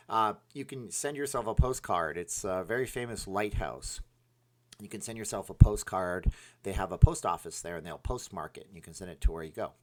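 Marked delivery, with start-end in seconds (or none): none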